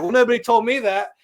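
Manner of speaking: high, loud, exasperated comeback; retold indignant protest. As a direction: protest as if wrongly blamed, acted out. Retold indignant reply to girls.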